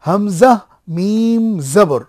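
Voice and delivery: Hamza then মিম জবর drawn out very slowly; slow spelling demo. Shown as a demonstration. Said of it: "এইটা বানান".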